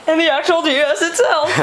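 high, gushing happy reveal; awkward joy. Real, not acted. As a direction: gush over it, a little embarrassed. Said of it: "Sorry, I'm just so happy".

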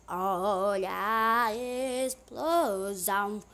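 very slow, stretched-out words; drawn-out call. Pretend. Gamer announces the bomb blast, very slow and drawn out. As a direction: stretch every vowel of the announcement.

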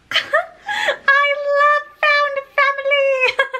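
very high, drawn-out tearful wail; overwhelmed squeal. Real, not acted. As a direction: squeal it slowly through happy sobs. Overwhelmed by the found family.

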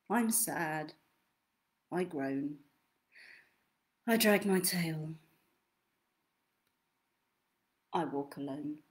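low, dragging sad voice; sad groan. Acted. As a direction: sink down low and mournful. Purple sad days verse.

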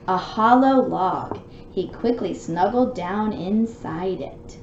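lively read-aloud storybook voice; story narration. A narrator's reading. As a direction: read it out like bedtime for a little one. Reads storybook to child.

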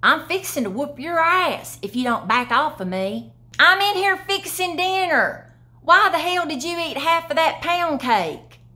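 mock-angry wife fussing over pound cake; sassy Southern woman. Shown as a demonstration. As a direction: scold like a mama in the kitchen. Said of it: "It means you're about to do something".

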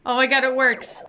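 ordinary delivery

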